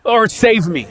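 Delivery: high, loud plea for rescue; a sudden pleading shout to the Lord; desperate cry. Acted. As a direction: cry it out like a drowning man. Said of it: "we cry out".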